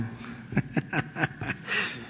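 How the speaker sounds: soft, drawn-out chuckle in pulses; amused chuckle. Real, not acted. President laughing off talk of his age, amused chuckle. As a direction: chuckle softly at the jibe. Laughs at being called doddering.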